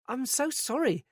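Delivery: a rattled woman groping for words; flustered. A narrator's reading. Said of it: "Joyce was flustered now".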